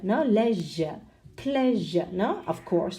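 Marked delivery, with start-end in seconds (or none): 0.2-2.1 "Leisure", "pleasure" ending in a weak "zhuh", no r; non-rhotic "lezhuh, plezhuh"; received pronunciation. Shown as a demonstration. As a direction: say "Leisure" and "pleasure" British style, schwa ending. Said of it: "bearing in mind received pronunciation".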